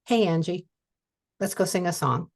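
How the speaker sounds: usual speaking voice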